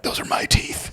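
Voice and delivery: unvoiced muttering under breath; mumbling. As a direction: mutter it to yourself. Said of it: "mumbled things under his breath".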